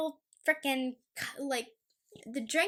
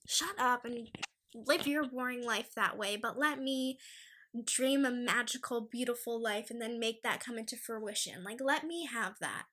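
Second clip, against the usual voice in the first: ordinary talk, nothing marked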